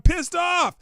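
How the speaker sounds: hammed-up angry host shout; pissed off. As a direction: bellow the complaint like a catchphrase. Ranting host repeats catchphrase.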